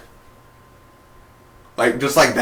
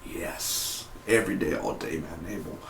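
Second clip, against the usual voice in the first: much softer, low whispered adoration; hushed fan gush; 7 semitones lower, 17 dB quieter than usual. Retold or imitated. Fan murmuring about the actor, hushed. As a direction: murmur it like worship. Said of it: "I'm like".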